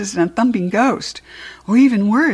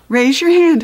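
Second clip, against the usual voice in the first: high, louder teacherly correction; teacher's reminder; 6 semitones higher, 6 dB louder than usual. A narrator's reading. Teacher corrects Heidi for calling out, high scolding reminder. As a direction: remind a pupil firmly, pitched up.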